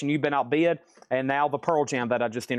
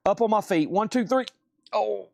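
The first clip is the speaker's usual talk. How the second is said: straining while standing up from stretching; stretching groan; 7 semitones higher than usual. Real, not acted. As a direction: groan with effort getting to your feet. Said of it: "Sorry, I'm stretching right quick".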